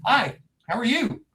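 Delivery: high, lilting hello; retold doorstep greeting. Investigator greeting a man at his door, retold lightly high-pitched. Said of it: "I knock on the door".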